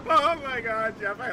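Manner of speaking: laughing. Real, not acted. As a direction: laugh it out after the punchline. Laughing at the joke.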